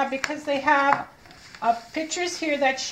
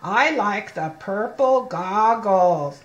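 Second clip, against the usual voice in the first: slow reading, each word apart; slow model reading; 3 semitones lower, 4 dB louder, slower than usual. Shown as a demonstration. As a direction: read it slowly so the child copies the mouth. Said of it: "look at me".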